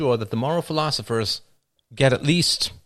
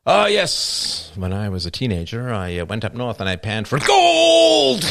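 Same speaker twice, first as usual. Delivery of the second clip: sportscaster telling a story; 4 dB louder than usual. Acted. Sportscaster recalling his teens, bursting into goal-style shout. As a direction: drift along, then explode on "gold". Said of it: "probably even get homonyms down".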